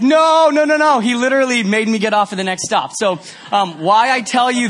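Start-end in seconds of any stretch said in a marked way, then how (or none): 0.0-1.0 loud, higher panicked nos; frantic denial. Retold or imitated. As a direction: wave it off desperately. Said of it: "and I was like".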